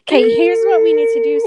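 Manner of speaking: eager, bursting announcement; excited. Real, not acted. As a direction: blurt out the big idea. Excited sudden plan.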